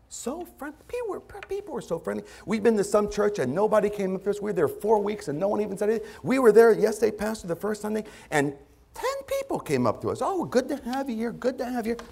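high, light imitation of impressed visitors; gushing church visitor. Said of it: "what I hear from a lot of people who visit".

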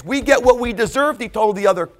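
loud, high insistent cry; fervent rebuke. Acted. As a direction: rebuke the other criminal. Thief rebuking other.

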